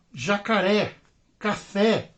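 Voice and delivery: ordinary delivery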